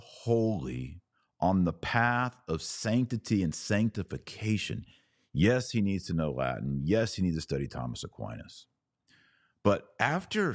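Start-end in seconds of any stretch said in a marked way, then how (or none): none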